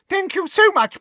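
very high, loud squeaky voice. A narrator's reading. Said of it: "in his squeaky little voice".

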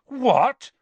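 high, loud disbelief; shocked gasp. A narrator's reading. Harry learning who has been taken, gasping. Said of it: "Harry gasped".

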